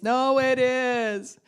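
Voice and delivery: drawn-out high insistent "no, it is"; stubborn insistence. Retold or imitated. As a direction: dig in and stretch the words like a stubborn kid. Stubborn arguing back.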